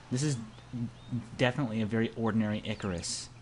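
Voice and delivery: normal talking voice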